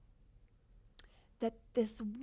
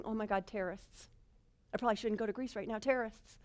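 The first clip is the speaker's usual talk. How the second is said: quick, softer, fretting inner voice; mock anxiety; 4 semitones lower, 7 dB quieter, faster than usual. Traveller panicking about the Greece trip, quick mock worry. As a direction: rattle off the worry under your breath.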